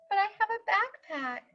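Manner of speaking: high, soft, quick plea; pleading Rufus. Acted. Rufus pleading with the principal, high and soft. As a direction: plead it softly.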